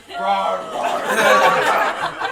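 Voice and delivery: drawn-out imitated roar with laughter; mock roar. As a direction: mimic a silly monster roar, laughing. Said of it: "Gotta do better than roaring".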